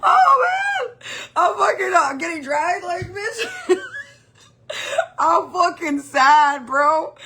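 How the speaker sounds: high, loud, laugh-crying voice; squealing, real laughter breaking into the words; laughing hard. Real, not acted. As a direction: talk through helpless giggles. Cracking up over her predicament.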